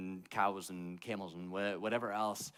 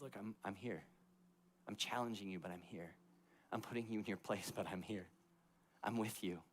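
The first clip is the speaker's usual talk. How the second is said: soft comforting God; 6 dB quieter than usual. Retold or imitated.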